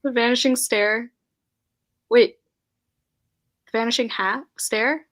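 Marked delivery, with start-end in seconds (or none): none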